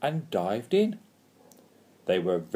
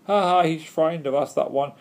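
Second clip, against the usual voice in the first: raised, loud taunting; gloating heckle in a higher voice; jeering; 5 semitones higher, 5 dB louder than usual. A narrator's reading. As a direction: jeer with a mocking ha ha. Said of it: "The Pooks jeered at him too".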